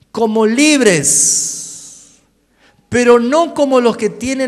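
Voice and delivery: versículo proclamado fuerte y despacio; lectura predicada. Acted. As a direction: predica el texto con toda la fuerza. (lee el versículo a la iglesia, fuerte y lento)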